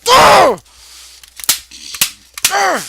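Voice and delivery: loud, high-pitched roar of frustration; furious wordless yell; frustrated scream. Acted. Furious outburst during rant.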